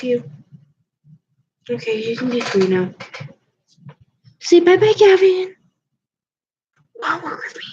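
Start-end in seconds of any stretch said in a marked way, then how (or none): 4.4-5.5 high, loud sing-song bye; sing-song farewell. Real, not acted. Baby talk farewell to cat. 7.0-7.6 unvoiced whisper to the cat. Real, not acted.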